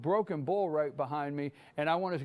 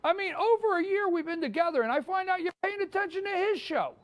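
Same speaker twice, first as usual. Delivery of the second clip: high-pitched, put-on wounded complaint; hammed-up jealous protest; mock hurt; 11 semitones higher than usual. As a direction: act betrayed, voice way up. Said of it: "I'm hurt".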